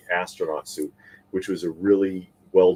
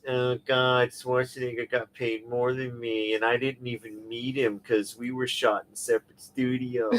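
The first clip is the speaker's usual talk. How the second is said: whiny, high celebrity complaining; 4 semitones higher, 4 dB quieter than usual. Retold or imitated. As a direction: whine like a spoiled star. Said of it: "George Clooney whiny voice".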